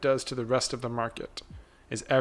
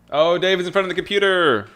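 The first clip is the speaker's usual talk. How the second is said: calling out; 5 semitones higher, 9 dB louder than usual. Real, not acted. Calling out to David.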